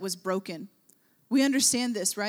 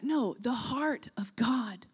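slow whisper; 4 dB quieter, slower than usual. Real, not acted. Preacher whispering to the congregation about God's heart. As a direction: hush it, slowly.

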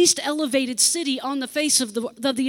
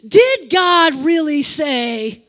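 slow, insinuating Did God really say, higher and louder; tempter's question; 5 semitones higher, 7 dB louder, slower than usual. Acted. The enemy whispering doubt to believers, slow insinuating voice. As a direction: plant the doubt, drawn out. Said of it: "make you question".

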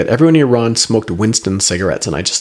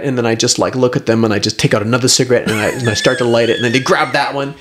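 normal talking voice

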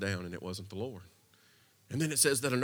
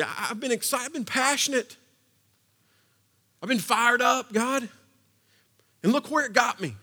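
higher, louder exasperated plea; complaining plea; 9 semitones higher, 8 dB louder than usual. Acted. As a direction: pour out a frustrated complaint to God. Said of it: "Elijah says".

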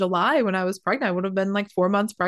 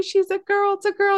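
much higher and louder, joyful cry; excited announcement; 13 semitones higher, 4 dB louder than usual. Retold or imitated. Family announcing the newborn to the mother, high excited call. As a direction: imitate the family calling out with delight. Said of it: "They're like".

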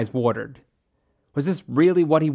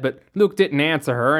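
plain reading voice

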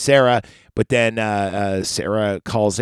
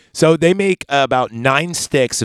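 normal talking voice

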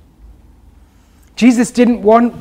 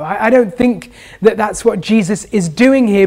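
ordinary talk

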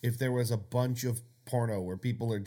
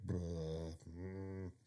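bored; 6 semitones lower, 14 dB quieter than usual. Pretend. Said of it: "just like".

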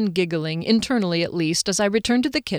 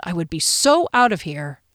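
ordinary delivery

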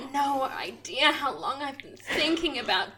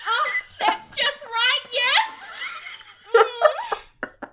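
higher, giggly encouraging moans; 12 semitones higher, much slower than usual. Acted. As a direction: moan encouragement, half laughing. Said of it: "moans in encouragement".

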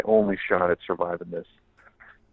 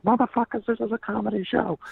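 high yell; 14 semitones higher than usual. Retold or imitated. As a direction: yell at the rowdy crowd. Comic quieting a rowdy crowd.

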